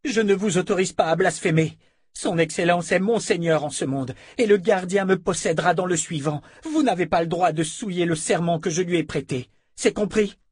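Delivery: high-pitched angry scolding; indignant dark sister. Acted. Alessandra scolds the abbess.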